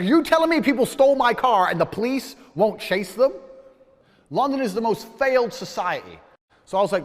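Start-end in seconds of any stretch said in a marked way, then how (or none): 0.0-3.3 raised, louder, outraged disbelief; louder, higher, furious retelling; indignant outburst. Retold or imitated. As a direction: blast the question at the officer. Outraged at police refusal.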